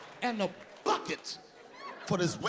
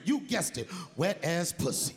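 ordinary delivery

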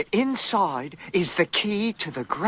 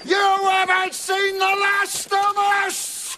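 defiant shout; 8 semitones higher, slower than usual. Acted. Guard shouts a defiant threat.

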